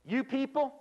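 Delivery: high, loud mock indignation; mock accusation. Trainer showing the expression to avoid, mock accusing tone. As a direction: throw it out like a hammed-up insult. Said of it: "don't use this expression".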